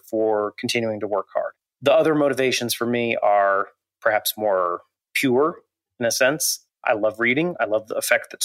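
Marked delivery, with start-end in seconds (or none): none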